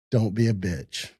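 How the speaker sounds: understated tough-guy warning; quiet menace. Retold or imitated. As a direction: say it low and slow, like a quiet threat. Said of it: "I went like this".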